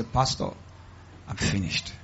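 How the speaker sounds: low, hushed imitation of a defeated caller; mock despair. Caller complaining to the pastor counselor, mock despair. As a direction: imitate a caller barely getting it out. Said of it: "when people call me".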